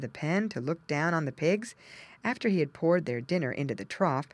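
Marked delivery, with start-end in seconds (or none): none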